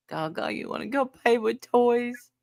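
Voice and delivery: lightly voiced eager toddler; grandchild quote. Grandson inviting grandma to play, light kid voice. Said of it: "first time it was".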